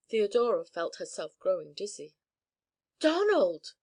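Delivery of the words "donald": high, loud gasp of a name. A narrator's reading. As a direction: gasp it in shock. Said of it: "she gasped".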